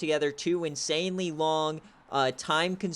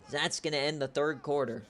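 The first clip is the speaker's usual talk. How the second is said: ordinary talk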